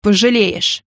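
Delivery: understated menacing warning; barked threat. A narrator's reading. Richard threatens Bert, mildly growling. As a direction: threaten in one firm word. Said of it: "рявкнул Ричард".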